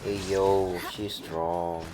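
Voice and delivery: usual speaking voice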